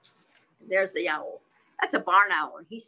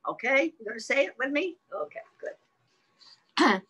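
ordinary delivery, nothing marked

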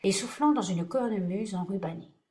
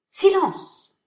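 shouting; 12 semitones higher, 9 dB louder than usual. Acted. Audience member calls for quiet.

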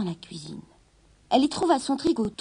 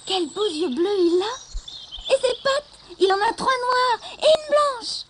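excited; 11 semitones higher, 5 dB louder, slower than usual. A narrator's reading. Children admiring the kitten.

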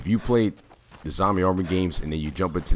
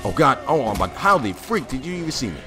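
higher and louder, exasperated disbelief; caught-out outburst; 9 semitones higher, 6 dB louder than usual. Real, not acted. Player spotted by an enemy, loud exasperated disbelief. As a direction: blurt it out after being spotted.

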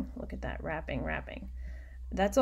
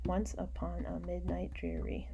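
whispering the line while counting syllables; whispered counting; 8 dB quieter than usual. Shown as a demonstration. Teacher whispers the line to count its syllables. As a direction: whisper it, counting each beat. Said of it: "I'm counting with my fingers".

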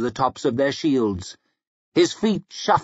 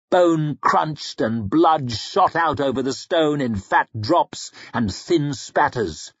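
ordinary delivery, nothing marked

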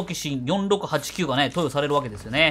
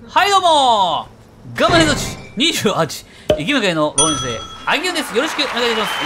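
YouTuber intro greeting; 5 semitones higher, 5 dB louder than usual. Acted. Host greets viewers to open the episode, loud and stretched. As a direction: belt out the channel greeting, stretching the first word.

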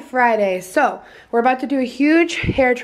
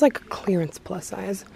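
normal talking voice

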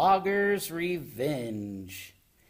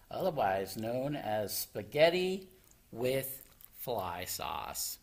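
ordinary delivery

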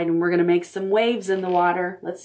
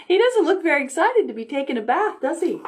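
high, fast teasing question; playful; 8 semitones higher, faster than usual. Acted. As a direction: tease the child about the bath, quickly. Teasing comment to a child.

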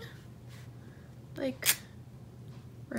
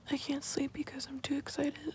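whispering; 4 semitones higher, 9 dB quieter than usual. Real, not acted. Late-night excited unboxing.

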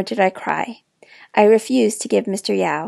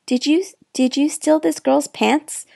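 higher, questioning motel owner voice; stern manager; 5 semitones higher than usual. A narrator's reading. Manager questioning the girl about stolen pants, stern. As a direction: press her like an accuser. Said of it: "Mister Yao asked".

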